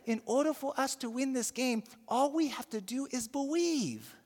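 a hint of the little boy repeating his line; earnest boy again. Boy repeating his winning advice to the coach, earnestly. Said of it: "he dropped the line again".

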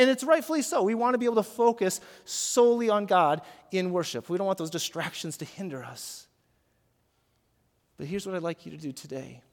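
ordinary talk, nothing marked

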